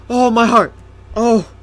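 normal talking voice

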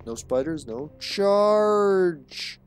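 high, drawn-out "charge!"; silly high war cry held long; mock battle cry. Mimics a monster's battle cry.

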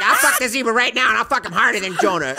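loud, high imitation of one-upmanship; hammed-up competitive bragging; escalating mock boast.

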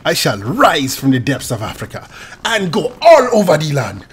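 grand mock-African proclamation; African accent. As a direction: declaim in a put-on African accent. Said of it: "You should just talk like that".

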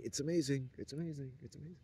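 high gushing that fades to a hush; awed gushing. Retold or imitated. A man mock-gushing about women, fading into a hush. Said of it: "you automatically say".

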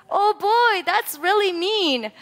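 high, slow mock shock; mock dismay. Real, not acted. Teacher reacting to student's karate chop, mock dismay. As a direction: act playfully appalled at the chop.

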